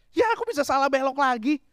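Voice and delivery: high-pitched mock nagging. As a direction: imitate a wife scolding at a wrong turn. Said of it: "terus dia ngomel". (tiruan istri ngomel bernada tinggi)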